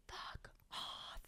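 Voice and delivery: retold scream held down to a whisper; hushed, voiceless stand-in for a yell; whispered mock yell. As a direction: whisper it as if screaming at full lungs. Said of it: "I yell at them at the top of my lungs".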